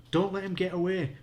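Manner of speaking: high, loud call to stop the dog; yelling. A narrator's reading. Josh yells to her as the dog runs, high and loud. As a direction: shout it after the fleeing dog. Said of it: "Josh yelled".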